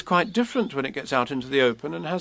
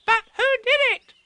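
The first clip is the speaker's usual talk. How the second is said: put-on very high small child voice; eager little kangaroo; Roo; 5 dB louder than usual. A narrator's reading. Roo asking who did it, very high.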